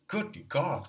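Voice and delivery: shocked high cry of Good God; cried out. A narrator's reading. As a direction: Luzin exclaims in shock at Raskolnikov's accusation, crying out. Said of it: "cried Luzin".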